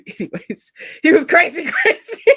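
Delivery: loud laughing speech. Real, not acted. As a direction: laugh through it. Laughing about stalker.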